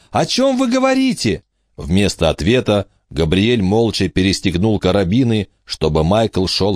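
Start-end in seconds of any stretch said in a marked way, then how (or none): 0.1-1.4 shouting over wind. A narrator's reading. Emma keeps yelling.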